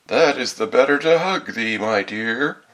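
high, loud mock-granny coaxing; wolf posing as grandmother. A narrator's reading. Wolf explaining its arms to the girl, fake granny voice. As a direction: reassure with false sweetness, high and loud.

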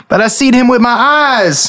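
high mock-twangy echo; lightly voiced hillbilly; imitating yokel. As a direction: tease by copying his voice. GM echoes player's yokel voice.

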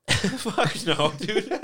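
laughing through the words; cracking up at the question. Real, not acted. Friend asks about Red Lobster, laughing. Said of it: "Sorry, I laughed".

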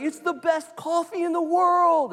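very high, loud overjoyed elf voice; Buddy the Elf. Retold or imitated. Buddy raving about the coffee shop, high and gleeful. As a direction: gush like a thrilled innocent elf. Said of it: "He's like".

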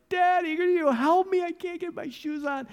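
put-on high, loud tearful child's plea; crying little boy. Sermon story, little boy begs his dad through tears. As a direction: beg like a small boy in tears. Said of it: "the little boy just bursts into tears".

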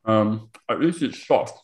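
talking through a mouthful of pie; words round a bite of food; mouth full. Real, not acted. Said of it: "Excuse my mouth full".

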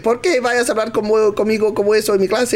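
high, fast Spanish tirade; mock screaming teacher. Teacher berating a student in her class, imitated screaming. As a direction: rattle off a high-pitched indignant scolding. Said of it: "screaming at me".

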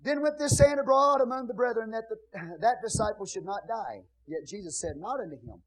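loud, ringing Bible reading from the pulpit; preacher reading the Gospel of John aloud with force; scripture reading. Acted. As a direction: proclaim the verses to the whole congregation. Reads scripture to the church.

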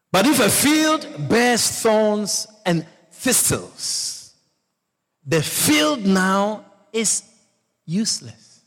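slow, deliberate reading of the verse; slow scripture reading. Acted. Pastor reading the verse to his congregation, slowly and solemnly. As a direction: read each word like holy writ.

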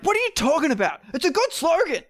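mocking the director's son brushing off the data; indignant director's son. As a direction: whine it like the boss's son who can't be questioned. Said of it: "And he's like".

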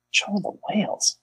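high breathless whisper of the title. Shown as a demonstration. Repeats title, second time whispered.

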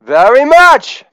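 high, loud, slow "Thank you very much"; rock star thanks. Acted. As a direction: draw out the thanks like a rock idol.

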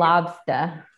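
lobster said the Boston way, no r; Boston accent on lobster. Retold or imitated. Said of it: "Is it like lobs".

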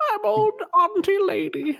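very high, slow, hammed-up auntie voice; overdone frail old lady. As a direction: oversell a frail granny voice. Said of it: "way overselling the".

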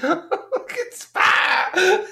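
high voice choked with laughter; words squeezed through giggles; laughing too hard. Real, not acted. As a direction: try to quote it while cracking up. Still laughing while quoting character.

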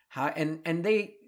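ordinary talk, nothing marked

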